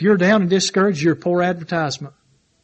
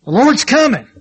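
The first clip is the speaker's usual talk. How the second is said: proclaiming; 5 semitones higher, 4 dB louder than usual. Acted. Preacher proclaiming the coming.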